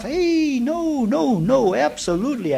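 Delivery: high, loud, squeaky mock refusal; a scandalized skeptic squealing no; mock indignant protest. Teacher mocks the scriptures with a high, loud scandalized refusal. Mocking reply to scripture quote.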